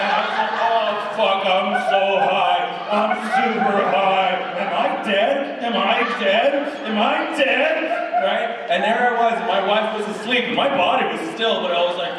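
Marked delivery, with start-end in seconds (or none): 0.6-7.7 loud rising panicked questions; mock panic. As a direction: spiral into panic, louder each time. Panicking while high.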